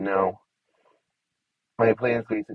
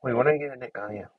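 usual speaking voice

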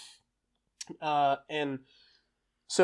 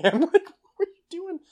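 high, loud laughing disbelief; incredulous laugh; 12 dB louder, slower than usual. Retold or imitated. Mocking recruits who can't tie boots, laughing incredulous.

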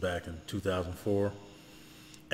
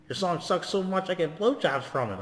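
high voice impression; 9 semitones higher than usual. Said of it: "He was like".

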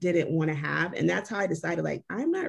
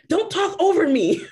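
raised, higher, indignant snap; mock indignant; 9 semitones higher, 7 dB louder than usual. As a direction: play the touchy date scolding her. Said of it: "he goes".

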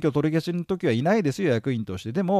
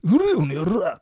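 delinquent tough talk; 3 semitones higher, 4 dB louder than usual. Retold or imitated. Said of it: "ってね".

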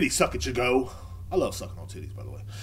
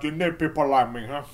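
lightly voiced gangster with a challenging tag; rough gangster impression, goading; Scarface. Banned man to his accusers, mock Scarface. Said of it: "can't do a good Scarface impression".

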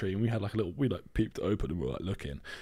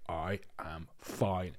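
very slow, softer stubborn insistence; imitating granny; 5 semitones lower, 10 dB quieter, much slower than usual. Granny to her daughter, slow, soft stubborn denial. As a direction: drag each word out, daring them to argue. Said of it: "And she's like".

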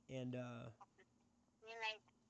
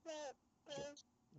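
high sweet goodnight; 12 semitones higher, 7 dB quieter than usual. Real, not acted. Dad says goodnight to kids.

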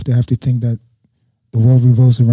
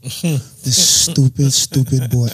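very slow, drawn-out mock despair; imitating a friend's drawn-out disbelief; exasperated drawl; much slower than usual. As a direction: stretch out stupid like a weary groan. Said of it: "Saeed just held his head like".

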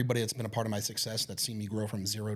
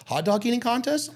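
high, eager question, much higher; excited; 10 semitones higher, 4 dB louder than usual. Retold or imitated. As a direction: perk right up, high and eager. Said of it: "You're like".

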